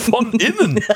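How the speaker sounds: high, laughing question; giggling disbelief. Real, not acted.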